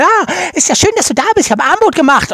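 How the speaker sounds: high, fast excited woman; quick woman's voice. Retold or imitated. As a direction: rattle it off in a high voice. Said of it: "die sagt schnell".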